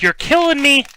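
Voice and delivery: high, loud put-on movie line; movie quote voice. Quoting a movie to the crowd, high put-on voice. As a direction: belt the line like the film character. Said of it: "And he's like".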